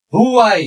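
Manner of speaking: "why" stretched into "hoo-eye"; H pronounced. Shown as a demonstration. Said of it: "যদি এইচ এর উচ্চারণ হয়".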